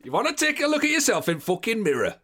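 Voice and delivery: a hint of a ranting northern manager; imitating Warnock. Imitating Warnock's dressing-room rant.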